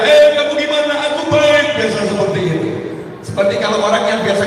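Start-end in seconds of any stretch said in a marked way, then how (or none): 0.0-1.7 mock shout of a village man; villager shouting. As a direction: call out like across the fields. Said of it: "biasa kalau ngomong teriak-teriak".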